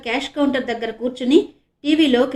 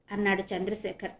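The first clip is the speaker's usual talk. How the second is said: ordinary delivery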